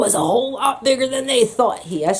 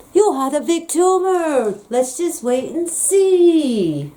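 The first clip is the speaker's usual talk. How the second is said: higher, slowed sing-song parody of a doctor; mocking lazy doctors; 6 semitones higher, slower than usual. Lazy doctors brushing off the patient, lightly mocked higher. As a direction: lightly mimic a dismissive doctor. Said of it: "from lazy doctors".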